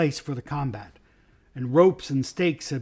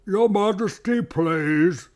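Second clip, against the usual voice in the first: thick, drowsy bear, pitched up; thick sleepy voice; 6 semitones higher, 4 dB louder, much slower than usual. A narrator's reading. Old bear petitioning the king, thick sleepy voice. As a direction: plead slowly through a sleepy haze. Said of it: "suddenly a thick, sleepy voice said".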